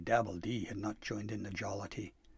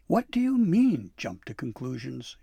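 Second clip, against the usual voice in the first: snapping; 3 semitones higher, 8 dB louder than usual. A narrator's reading. Dabbledy forgetting she addresses Santa, snapping. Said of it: "she snapped".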